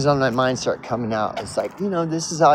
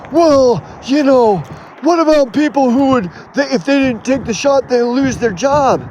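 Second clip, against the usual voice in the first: whiny critic voice, much higher and louder; mocking critic; 9 semitones higher, 4 dB louder than usual. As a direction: mimic the objector, high and pleading. Mocking an imagined critic's objection.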